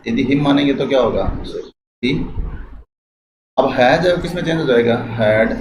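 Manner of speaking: ordinary delivery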